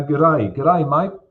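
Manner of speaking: lightly put-on Australian greeting; Australian accent. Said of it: "That's my Australian impression".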